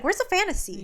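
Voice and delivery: mock outrage. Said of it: "I'm like".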